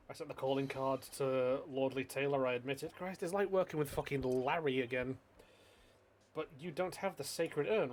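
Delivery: usual speaking voice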